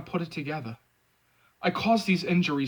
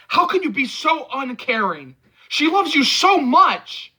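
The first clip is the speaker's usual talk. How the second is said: loud, high and slow; angry plea; 10 semitones higher, 6 dB louder, slower than usual. A narrator's reading. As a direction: plead through anger. Pleading angrily.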